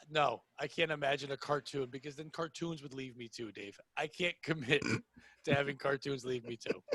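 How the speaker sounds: low, soft, deadpan hurt; mock-wounded confession. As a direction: confess old hurts quietly, playing it straight.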